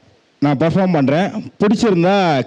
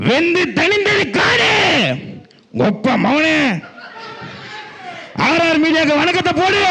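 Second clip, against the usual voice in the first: swaggering movie one liner. Acted. Movie title delivered as punchline.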